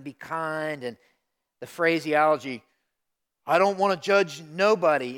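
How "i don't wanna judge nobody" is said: loud, raised-pitch imitation of a slogan; mock mantra. Preacher quoting young believers' mantra of kindness, a loud mock proclamation. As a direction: proclaim it high and loud, mimicking others. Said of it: "has kinda become the mantra".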